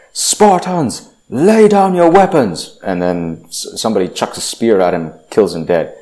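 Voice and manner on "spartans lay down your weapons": much higher, commanding shout; commander's yell. Retold or imitated. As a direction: bark the order across the battlefield. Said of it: "that Persian commander yells out".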